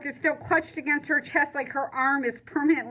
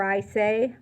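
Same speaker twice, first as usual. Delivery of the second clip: ordinary delivery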